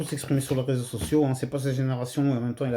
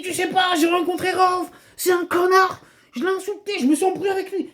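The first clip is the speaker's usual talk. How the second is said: much higher, breathless excited retelling; worked up. Retold or imitated. Younger brother bursts in boasting about insulting Rof, excited high voice. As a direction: blurt it out all wound up. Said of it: "tout énervé, excité".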